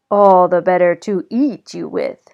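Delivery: loud hungry snarl. A narrator's reading. Wolf about to eat the girl, loud hungry snarl. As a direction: snarl it before pouncing. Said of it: "snarled the loud, hungry voice".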